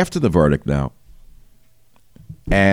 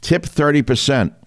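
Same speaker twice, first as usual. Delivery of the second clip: mock shout; 8 semitones higher than usual.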